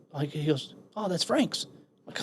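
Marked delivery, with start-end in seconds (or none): 0.9-1.7 much higher voice for the other guy; casual innocent reply. Retold or imitated. Young Marine answering the angry questioner, casual and high. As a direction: lightly mimic an unbothered reply, pitched up.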